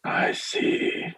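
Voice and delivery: brief whispered acknowledgement; whispering priest. Acted. Priest answers the peddler's hedged offer, whispered. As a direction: whisper it, reserved.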